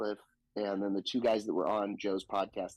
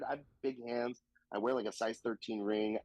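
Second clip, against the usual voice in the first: ordinary delivery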